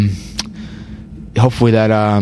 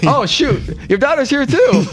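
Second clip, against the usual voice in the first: much higher, caught-out mock panic; flustered player; 12 semitones higher than usual. Player caught by the coach with his daughter, high mock fluster. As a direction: squeak it like you just got caught.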